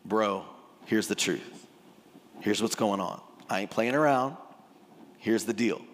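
low, matter-of-fact levelling; level with him in a low voice; straight-talking. Acted. Jesus leveling with Nicodemus, low no-nonsense straight talk. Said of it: "really, here's what he's saying".

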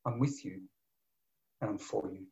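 low, hushed and fading; soft whisper. Acted. God whispering to Elijah, soft and low. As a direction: breathe it like a secret promise. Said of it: "God is whispering".

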